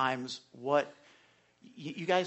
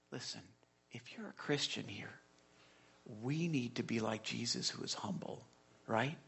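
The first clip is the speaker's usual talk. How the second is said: ordinary talk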